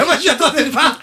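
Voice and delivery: laughing through the words. Real, not acted. Laughing at the joke.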